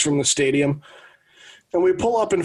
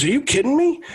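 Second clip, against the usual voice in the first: retold disbelief; 11 semitones higher than usual. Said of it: "And my dad goes".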